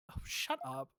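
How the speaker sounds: low, hushed grumble; muttered retort. Acted. Player grumbles back at a smug game character, muttering low. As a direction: grumble it under your breath.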